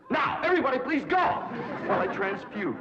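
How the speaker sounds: high, loud and imperious; loud dismissal. Acted. Teacher commands the family to leave, loud and high. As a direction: order everyone out grandly.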